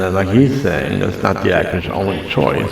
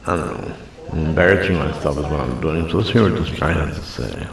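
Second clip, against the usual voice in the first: ordinary delivery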